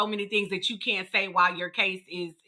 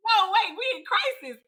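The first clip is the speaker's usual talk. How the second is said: alarmed protest; 10 semitones higher than usual. Retold or imitated. Friend retells her own alarm.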